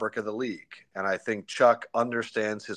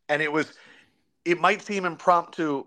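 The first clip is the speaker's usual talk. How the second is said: normal talking voice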